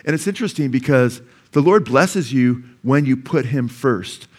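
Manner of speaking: ordinary delivery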